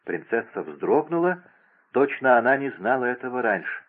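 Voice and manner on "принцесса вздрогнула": usual speaking voice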